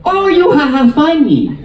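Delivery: put-on high, delighted Chinese-accented nail lady; hammed-up Chinese accent, pitched high; imitated Chinese accent. Nail lady grasping the job, imitated Chinese accent. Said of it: "That's her accent".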